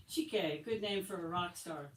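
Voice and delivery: ordinary delivery, nothing marked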